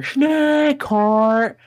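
high, loud, drawn-out mimicked holler; mock yell. Teller mimics the call heard every day, mock yell. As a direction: imitate a piercing, stretched-out yell.

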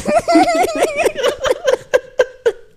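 shrieking laugh; high cackling laugh. Real, not acted. Joke about sticking out tongue.

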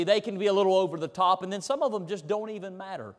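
usual speaking voice

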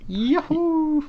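high-pitched mock whoop; playful cheer. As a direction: let out a playful, drawn-out yahoo. お便り回を喜ぶ掛け声. (おどけた高い歓声)

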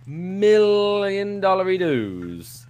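high, slow, stretched-out words; hammed-up big reveal. Real, not acted. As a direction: draw out the huge sum. Hams up the cocaine's value.

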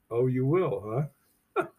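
usual speaking voice

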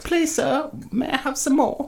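Oliver Twist plea. Retold or imitated. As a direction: beg up high like a little orphan boy. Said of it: "No, it's".

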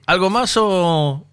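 slow, bored shopkeeper brush-off; drawn-out impatient. Retold or imitated. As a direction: drag it out as if hurrying the customer along. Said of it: "del chino".

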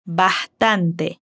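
Bastante with the S breathed out like an h, "bahtante"; aspirated S. Shown as a demonstration. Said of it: "también aspira la S al decir bastante".

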